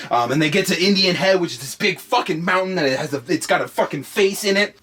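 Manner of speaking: normal talking voice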